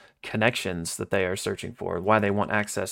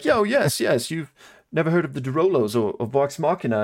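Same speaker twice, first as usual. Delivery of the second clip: much higher, fast, hammed-up boasting; boastful Bertrand; 5 semitones higher than usual. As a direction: play up a bragging showman.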